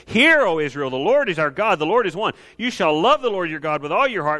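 ringing, declaiming read of the Shema; solemn scripture reading. Acted. Preacher reading Deuteronomy to the church, declaiming. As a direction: proclaim it to the congregation.

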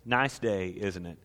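soft, low, friendly small talk; a soft, kind greeting to the woman at the well; gentle and kind. Retold or imitated. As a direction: kindly start a chat with a stranger. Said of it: "He was just kind".

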